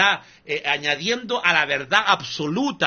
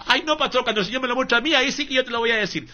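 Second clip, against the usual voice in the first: higher, quick, teasing believer's voice; mock coy believer; 7 semitones higher, faster than usual. Preacher mocking a believer who refuses to hand over her wallet, higher voice. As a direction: tease back as the dupe, a touch higher.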